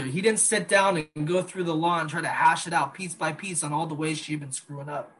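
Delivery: usual speaking voice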